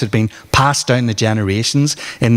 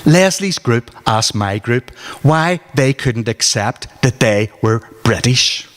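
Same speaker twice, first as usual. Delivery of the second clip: ordinary delivery, nothing marked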